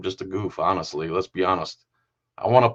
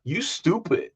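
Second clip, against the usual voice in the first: higher imitation, "stupid" missing its D; pool hall lady; 6 semitones higher, slower than usual. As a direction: mimic her "stupid" without the final D. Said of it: "doesn't put the D on the end".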